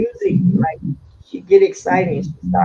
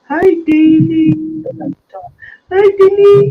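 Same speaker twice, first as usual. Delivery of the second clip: high, loud, drawn-out sing-song greeting; voicing mom's greeting; 8 semitones higher, 5 dB louder than usual. Mom greeting neighbor girl Dee Dee, high sing-song call. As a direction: call out a bright hello.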